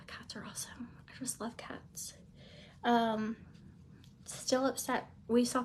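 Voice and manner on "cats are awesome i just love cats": whispered aside. Real, not acted.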